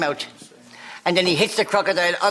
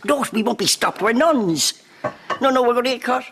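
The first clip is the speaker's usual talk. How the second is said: higher nun voice; 6 semitones higher than usual. Acted. Nuns arguing at the border.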